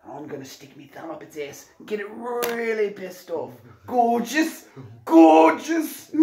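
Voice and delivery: high, excited Australian croc-hunter; broad Aussie accent, building to high delight; Steve Irwin. Acted. Host wrangling a giant croc, Steve Irwin impression. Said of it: "Steve Irwin".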